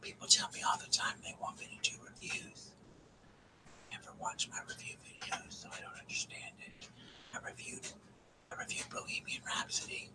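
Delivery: very soft, voiceless ASMR whisper. Shown as a demonstration. Streamer tells viewers about the reviews, whispering. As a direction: whisper it closely to the listeners. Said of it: "We'll just whisper like this to you".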